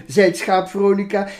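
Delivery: put-on lively, sing-song reading of a children's poem. Reading the Veronica poem aloud for the interviewer, animated. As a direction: read it out like a storyteller to kids.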